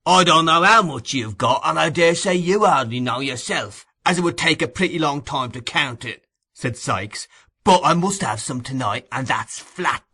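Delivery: no arguing, put your foot down; firm insistence. A narrator's reading. Sikes insists on money.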